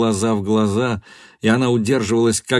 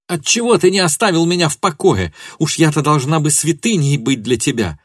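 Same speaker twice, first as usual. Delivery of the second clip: high, raised, impassioned accusation; furious tirade; 7 semitones higher, 4 dB louder than usual. A narrator's reading. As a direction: pour out hatred and hurt at him. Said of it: "закричала она".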